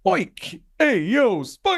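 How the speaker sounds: put-on high, stretched Jersey-accent call of the name. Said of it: "like, Jersey accent".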